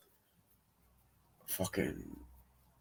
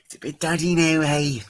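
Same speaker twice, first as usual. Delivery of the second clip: higher, brash put-on voice; jokey put-on voice; 7 semitones higher, 3 dB louder than usual. Reviewer joking about the body swap in a cheeky higher voice. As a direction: play it like a cheeky lad.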